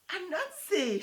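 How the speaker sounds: high, loud laughing cry of his name; choking with laughter. Acted. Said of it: "she choked".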